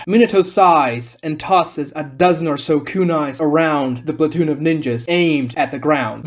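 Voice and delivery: plain reading voice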